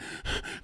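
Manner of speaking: imitated sobbing intake of breath; mock sob gasp. Imitating a crying girlfriend, mock sobbing gasp. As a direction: gasp as if mid-sob, put on. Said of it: "cry and sob".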